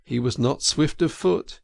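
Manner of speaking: usual speaking voice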